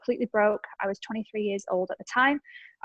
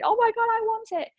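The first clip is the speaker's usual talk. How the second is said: gushing fan; 13 semitones higher than usual. Retold or imitated. Followers commenting on the leopard print gear, squealing high. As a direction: squeal like a fan who must have it.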